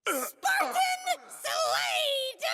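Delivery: drawn-out, crowing victory shout; gloating triumph. Acted. Grunt celebrates killing a Spartan, gloating triumph. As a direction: crow it as a battle victory.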